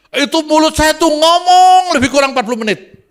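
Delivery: high, driving revival-preacher delivery; impassioned pulpit storytelling, pitched high; full-force preaching. Acted. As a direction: ride the climax of a testimony at full voice.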